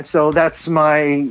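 ordinary talk, nothing marked

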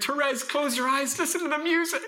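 high-pitched, hammed-up tearful plea; mock bawling. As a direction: imitate a dad wailing through his words. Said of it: "And I start bawling".

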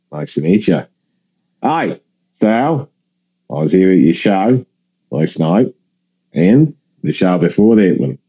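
low-key mock Aussie, halting phrases; Australian accent. Australian passenger who saw both shows, mock Australian accent. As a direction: imitate an Australian introducing himself. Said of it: "I apologize to my Australian listeners".

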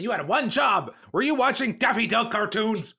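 high, incredulous scolding; hammed-up boss chewing out an employee; mock dressing-down. Sheridan dresses down Garibaldi, mock outraged scolding. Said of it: "Sheridan is dressing him down".

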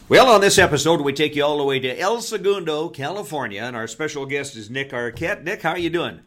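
upbeat announcer voice; podcast host intro. Acted. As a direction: open the show like a radio host. Host opens the episode.

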